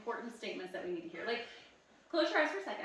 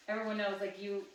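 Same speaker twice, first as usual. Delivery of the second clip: usual speaking voice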